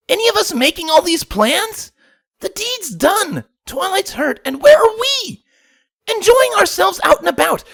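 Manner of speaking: high, loud, exasperated pony yell; frustrated shout. A narrator's reading. Rainbow yells at her friends, high and furious. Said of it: "Rainbow shouted in frustrated".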